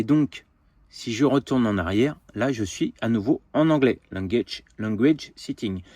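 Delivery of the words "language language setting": saying the English words with a light English accent; self-mocking English accent on language setting. Real, not acted. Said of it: "J'ai un accent anglais, au secours".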